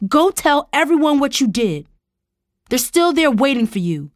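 high, loud angry challenge; barked. A narrator's reading. Tara dares uncle to confess to everyone, angry bark. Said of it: "Tara barked".

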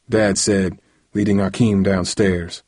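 plain reading voice

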